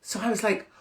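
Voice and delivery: ordinary talk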